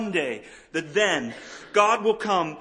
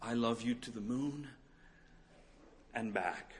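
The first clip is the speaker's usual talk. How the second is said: soft promise; 4 semitones lower, 8 dB quieter than usual. Shown as a demonstration. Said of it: "he was offering a promise".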